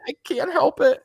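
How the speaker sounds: high-pitched, lightly acted tearful plea; mild mock whimper; retold crying. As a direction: imitate helpless crying, voice lifted high. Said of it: "I'm crying".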